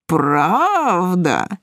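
long drawn-out, leering question; cocky guy savouring the word; suggestive interest. A narrator's reading. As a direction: stretch it with a swoop up and down. Guy responds suggestively, gaze clouding.

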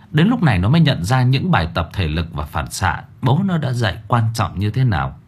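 plain reading voice

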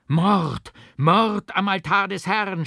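high, loud cry of alarm; horrified shout. A narrator's reading. The crowd sees the murder at the altar, screaming. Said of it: "schrie das Volk".

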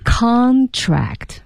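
"Contract" with a high, strong CON falling away; stress on first syllable. Shown as a demonstration. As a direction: punch the front of "Contract". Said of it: "我们把重音放在前面了". (把 Contract 的重音放在前面)

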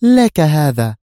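high, loud feminine agreement; assured temptress. A narrator's reading. Woman grants the boss's demand.

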